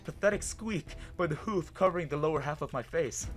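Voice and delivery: ordinary delivery, nothing marked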